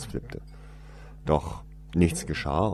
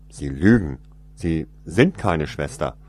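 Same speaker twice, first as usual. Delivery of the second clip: loud accusation; 8 dB louder than usual. Acted. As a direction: call out the lie firmly. Boy accuses woman.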